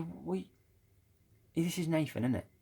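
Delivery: high-pitched mock bafflement; retold confused granddad. Granddad realising he has the wrong grandson, imitated high-pitched confusion. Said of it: "my granddad rang me up".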